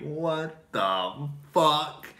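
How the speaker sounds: drawn-out, very slow disbelief; dumbfounded. Retold or imitated. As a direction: stretch it out in shock. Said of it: "And I was just like".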